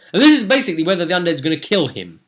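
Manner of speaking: normal talking voice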